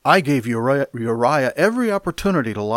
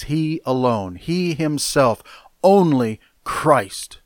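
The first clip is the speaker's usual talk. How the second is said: slowing down and growing louder on each phrase; emphatic; 5 semitones higher, 5 dB louder, slower than usual. Shown as a demonstration. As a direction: press hard on "He alone", "he himself", "only Christ". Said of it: "here in the Greek text is emphatic".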